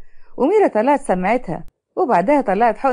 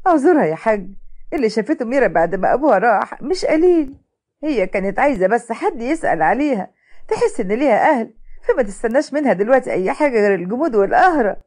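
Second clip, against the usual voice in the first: ordinary delivery, nothing marked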